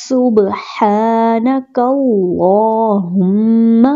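سبحانک اللہم chanted slowly, the lam of اللہم thick; slow tajweed recitation of سبحانک اللہم; heavy lam. Shown as a demonstration. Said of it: "اس لام کو موٹا پڑھا جاتا ہے".

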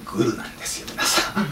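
hushed reveal; whisper. Real, not acted. Speaker tells the audience the collusion in a whisper. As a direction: whisper it like a secret.

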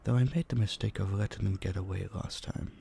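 ordinary delivery

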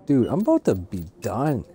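ordinary delivery

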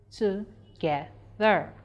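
"Together" slowly split into three separate syllables. Shown as a demonstration. Teacher showing three syllables in "Together", slow and separated. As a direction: break "Together" apart, pausing after each syllable. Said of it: "we have three syllables in that word".

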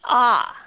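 O said loud and open, mouth wide; model O vowel. Shown as a demonstration. Teacher drilling the O sound for students, loud. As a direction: belt out an open O. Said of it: "các bạn nghe nó giống âm gì".

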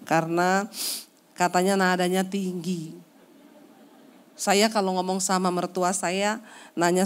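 normal talking voice

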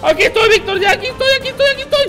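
high, loud repeated call; excited high-pitched shouting; urgent calling out. Real, not acted. Calling to teammate mid-fight.

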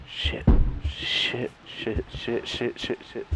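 frightened whispered shits; whispered cursing. Real, not acted. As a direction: breathe the curses out scared. Terrified, whispering curses.

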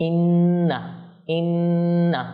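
ইন্না with a long hummed double n; ghunna on nun. Shown as a demonstration. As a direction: hold the nasal n of ইন্না before "naa". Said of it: "যেহেতু তাজদিদ গুন্না করব".

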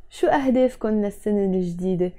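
fast, connected run of the whole question; natural quick pace. Shown as a demonstration. Teacher repeating the question to students, quickly. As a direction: run the words together fluently. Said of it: "Let's say it slower".

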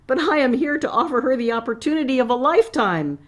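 high, fast squeaky plea. A narrator's reading. Flustered assistant to the teacher, high squeaky protest. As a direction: squeak it out in a rush. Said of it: "he squeaked".

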